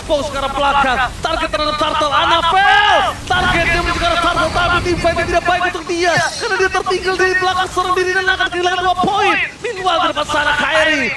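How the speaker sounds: loud, rising play-by-play; excited caster call. Real, not acted. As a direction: call the fight with hype.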